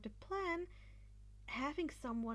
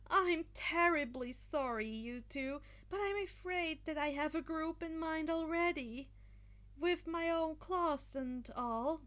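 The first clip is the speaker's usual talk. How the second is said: sassy; 6 semitones higher, 4 dB louder than usual. Acted. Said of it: "he responded with a sort of sass".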